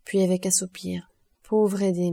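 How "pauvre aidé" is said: usual speaking voice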